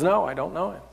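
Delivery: quiet, offhand brush-off; muted denial. Acted. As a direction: deny it under your breath. Peter denies knowing Jesus.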